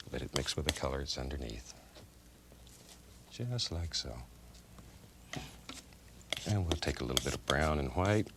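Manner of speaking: usual speaking voice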